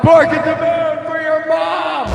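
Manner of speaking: high, long-held yell; howling shout. Acted. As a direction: howl it out, stretching the last word. Howling shouts over music.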